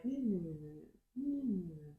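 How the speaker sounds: soft, falling lullaby-like hum; soothing motherly hum. Shown as a demonstration. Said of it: "like, a mother is soothing".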